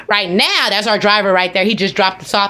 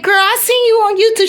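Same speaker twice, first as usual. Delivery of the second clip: excited shout-out; 10 semitones higher than usual. Retold or imitated. Shouting out a YouTuber.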